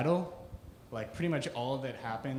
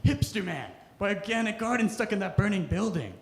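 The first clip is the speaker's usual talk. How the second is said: panicked hippie; 7 semitones higher, 5 dB louder than usual. Retold or imitated. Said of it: "a hippie would come after, up to him and be like".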